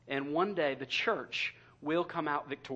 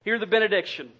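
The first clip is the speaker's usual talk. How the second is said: raised, projected blessing over the congregation; benediction; 2 semitones higher, 6 dB louder than usual. Real, not acted. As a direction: pronounce a solemn benediction, loud and measured. Pronounces the closing benediction.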